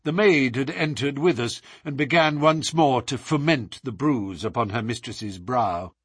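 ordinary delivery

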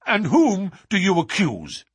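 high, loud challenge; urgent demand. Acted. Duke demands Holmes name the culprit, urgent.

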